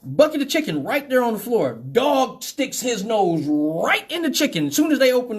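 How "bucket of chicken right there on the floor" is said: ordinary talk, nothing marked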